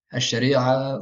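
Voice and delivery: ash-shari'a slowly, sh doubled; drawn-out ash-shari'a; assimilated article. Shown as a demonstration. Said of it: "on ne dit pas l".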